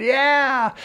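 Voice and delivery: high delighted yeah; excited cheer. Retold or imitated. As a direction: cheer like you just got lucky. Cheering at the news.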